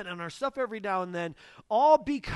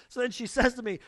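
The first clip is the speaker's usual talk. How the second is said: usual speaking voice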